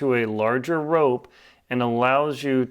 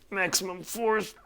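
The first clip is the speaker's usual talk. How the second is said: higher, softer, strained voice; straining with effort; 7 semitones higher, 6 dB quieter than usual. Real, not acted. Climber shows the knot gripping under full load, straining. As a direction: say it while pulling with all your might. Demonstrates pulling hard on the knot.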